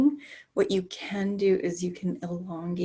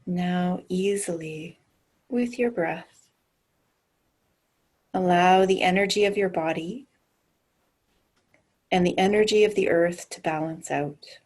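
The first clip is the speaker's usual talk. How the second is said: guided meditation. Acted. Leads a grounding meditation.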